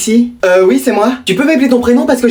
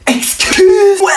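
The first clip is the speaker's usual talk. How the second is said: cheeky excuse; 10 semitones higher, much slower than usual. Acted. Kid's cheeky mock apology.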